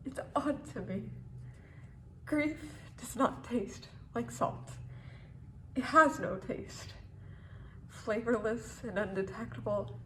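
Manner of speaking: soft, low, subdued ending; quiet resignation. Acted. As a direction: let it sink almost to a murmur. Poem ends in numb resignation.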